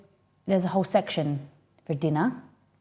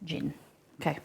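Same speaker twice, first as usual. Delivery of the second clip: normal talking voice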